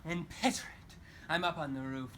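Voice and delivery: normal talking voice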